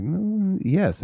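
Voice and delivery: high-pitched, wavering mock acceptance; hesitant yes. Imitating someone agreeing to a date with him, hesitant. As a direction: draw out a high mm, then agree.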